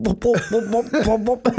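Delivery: high-pitched popping bubble sounds; bobbing-underwater noise. Retold or imitated. Kid who can't swim bobbles in river, comic bubbling. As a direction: imitate a child bobbing under water. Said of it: "bobbling in the water going".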